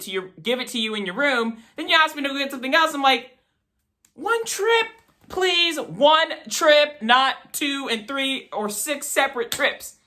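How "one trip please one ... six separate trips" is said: exasperated plea. Retold or imitated. Son exasperated at popcorn runs.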